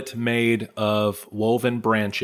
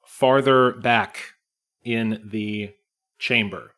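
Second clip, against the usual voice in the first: ordinary talk, nothing marked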